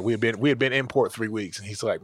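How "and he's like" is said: ordinary talk, nothing marked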